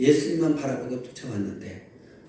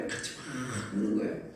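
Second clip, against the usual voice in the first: soft echo; 4 semitones lower, 9 dB quieter than usual. Shown as a demonstration.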